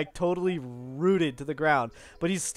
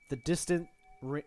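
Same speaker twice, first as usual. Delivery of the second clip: ordinary talk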